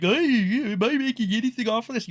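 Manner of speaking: high, fast mock complaint; Ethan Ralph crying. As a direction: imitate a crybaby's high-pitched protest. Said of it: "Ethan Ralph is crying now. He's like".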